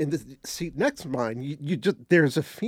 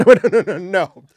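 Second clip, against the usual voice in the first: laughing no; 4 semitones higher, 12 dB louder than usual. Real, not acted. Mock refusal, laughing.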